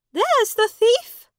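high, loud accusing cry; woman shouting at the boy; cried out. A narrator's reading. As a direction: call out, pointing him out. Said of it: "the woman cried out".

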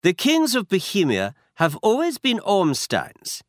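put-on a proud Bohemian noble speaking with a German accent; German-accented count. A narrator's reading. Masked count introduces himself, German accent. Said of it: "He had a strong German accent".

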